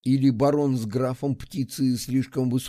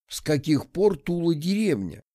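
ordinary delivery, nothing marked

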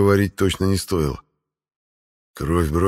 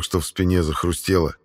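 ordinary delivery, nothing marked

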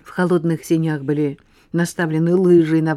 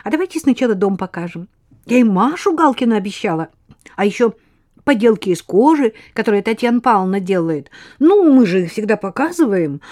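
rattling off; 5 semitones higher, 6 dB louder than usual. Acted. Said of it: "затараторила Лена".

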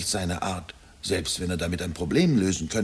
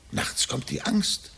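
fearful whisper; 13 semitones higher, slower than usual. Acted. Confessing his fear to professor.

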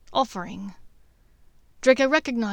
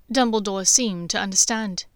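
plain reading voice

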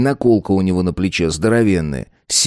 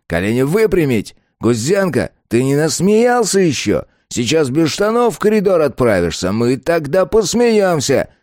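shouting drill sergeant; 6 semitones higher, slower than usual. A narrator's reading. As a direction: yell the push-up count at the recruits. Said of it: "и скомандовал".

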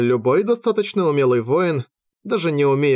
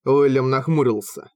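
ordinary delivery, nothing marked

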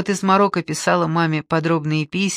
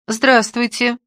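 curtsying greeting; 7 semitones higher, 5 dB louder, slower than usual. Acted. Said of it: "сделала книксон Катька".

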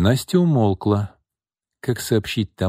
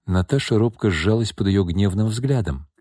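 plain reading voice

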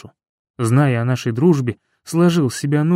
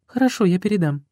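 higher, quick woman's voice; quick, higher, obliging; Yulia's voice; 4 semitones higher, faster than usual. Acted.

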